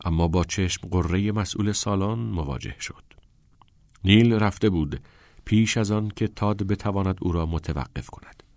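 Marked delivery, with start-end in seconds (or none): none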